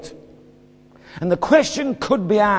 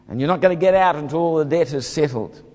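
normal talking voice

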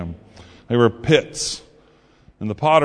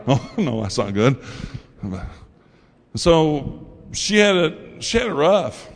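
normal talking voice

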